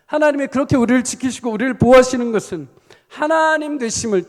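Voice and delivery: loud, high-pitched preacher's proclamation; forceful preaching. Real, not acted. Preacher urging the congregation on about their battle of faith, forceful. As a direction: drive it home to the congregation with force.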